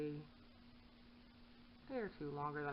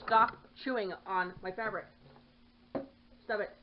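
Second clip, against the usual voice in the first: stern scolding; 3 semitones higher, 8 dB louder than usual. Real, not acted. Scolding cat.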